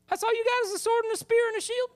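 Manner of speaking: high scornful taunt to Goliath; mocking David taunt. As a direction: sneer at the giant's weapons, high.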